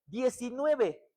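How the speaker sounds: loud, high-pitched fervent preaching; preaching at full force. Acted. Preacher exhorting the congregation on family prayer, fervent loud preaching. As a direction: drive it home to the whole church.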